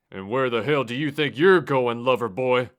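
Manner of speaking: loud, scornful demand; angry sneer. Acted. Driver confronting the narrator leaving the car, loud sneer.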